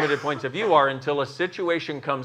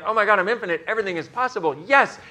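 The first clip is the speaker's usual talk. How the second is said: mock elation; 7 semitones higher, 3 dB louder, faster than usual. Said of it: "sometimes you're like".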